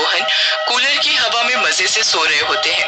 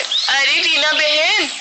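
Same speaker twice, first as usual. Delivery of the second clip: higher, showy voice with a giggle; boastful neighbour; 5 semitones higher than usual. Acted. Woman boasting to her neighbour about her new cooler, showy. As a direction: show off sweetly to a friend.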